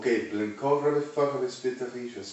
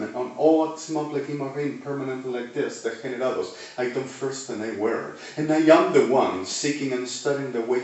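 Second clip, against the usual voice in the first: loud sermon proclamation against the wicked; full-force preaching; 3 semitones higher, 7 dB louder than usual. Acted. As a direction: thunder it at the congregation.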